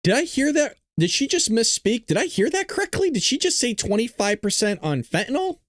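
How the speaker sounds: high, incredulous questions; can't believe what he just heard; real disbelief. Real, not acted. Streamer reacting to press secretary's fentanyl tariff line, stunned disbelief.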